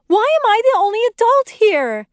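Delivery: high, loud, outraged scolding; shrieked exasperation; exasperated yell. A narrator's reading. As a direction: explode at childish pranksters. Lori scolds the pranksters after screaming.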